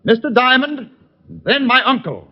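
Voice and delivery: projected commanding call; calling orders. Acted. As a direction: call the targets out to a man far away. Giving orders to distant gunman.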